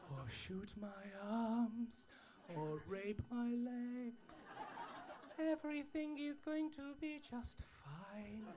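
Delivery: slow, soft calming voice; soothing plea. Acted. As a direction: talk the crowd down gently. Calming tannoy-style plea.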